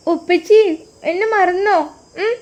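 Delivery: put-on high, childlike voice of a small daughter; little girl voice. Daughter calling her father on the phone, high childlike voice.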